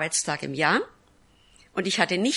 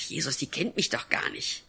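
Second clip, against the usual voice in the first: whispered protest to Jesus; 3 semitones lower, 6 dB quieter than usual. Retold or imitated. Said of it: "Und ich sagte".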